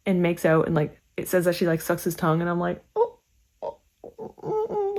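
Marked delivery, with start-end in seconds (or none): 2.9-4.9 breathy, then a high, soft, appalled oh; disgusted groan. Real, not acted. Reader cringing at a gross scene, appalled oh.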